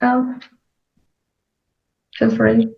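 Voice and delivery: ordinary talk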